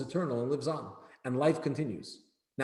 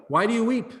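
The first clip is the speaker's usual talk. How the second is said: a lightly voiced exclamation, pitched higher and louder; exclaiming; 8 semitones higher, 5 dB louder than usual. A narrator's reading. Rabbi Chia to his weeping master, mild exclamation. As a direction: read the question as a startled outcry. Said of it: "exclaimed Rabbi Chia".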